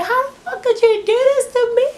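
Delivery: very high mock wail; squealed, frantic imitation; imitated screaming. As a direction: screech it as if betrayed, without full volume. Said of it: "She's just screaming".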